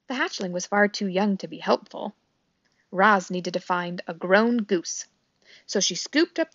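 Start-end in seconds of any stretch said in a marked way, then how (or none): none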